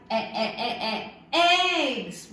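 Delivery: repeated "e" building to "eggs" with a laugh; sounding out eggs. Shown as a demonstration. Teacher praising a child, sounding out eggs with a laugh. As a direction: stretch the sound into the word. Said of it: "That's right".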